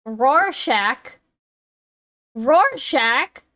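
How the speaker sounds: Rorschach with the second syllable rhyming with shack; British pronunciation. Shown as a demonstration. Streamer copying a British pronunciation clip, doubtful ROAR-SHACK for Rorschach. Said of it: "That's the British pronunciation".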